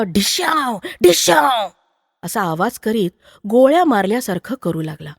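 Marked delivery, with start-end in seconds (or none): 0.0-1.7 child's pretend gun noises; explosive playful bang sounds, the last higher; mouth gunshots. A narrator's reading. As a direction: fire a toy gun with your mouth. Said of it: "तोंडानं".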